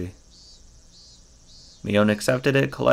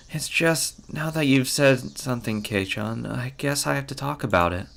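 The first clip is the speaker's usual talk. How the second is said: reluctant Mion; 5 semitones higher than usual. Acted. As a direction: confide reluctantly in a lighter voice. Voices Mion in visual novel.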